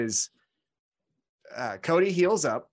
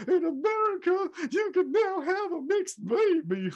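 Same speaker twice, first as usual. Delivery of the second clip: Cody impression. Mocking Cody's speech to Drew in a high hammy voice. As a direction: ham up a syrupy patriotic sermon. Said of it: "talking about".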